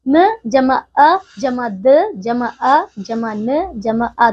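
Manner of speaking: very slow, each sound spelled out with pauses; slow sound breakdown. Shown as a demonstration. Teacher splitting a word into sounds for children, very slow. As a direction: spell लड़का sound by sound, slowly. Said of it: "Madan में जैसे कि क्या होगा".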